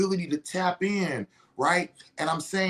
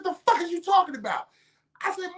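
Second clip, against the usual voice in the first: much higher, quick acted disbelief; incredulous retort; 12 semitones higher, faster than usual. Debater answering the dire warning, incredulous retort. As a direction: challenge him as if he talks nonsense. Incredulous reply in retold argument.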